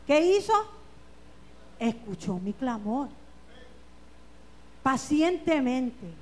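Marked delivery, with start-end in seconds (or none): none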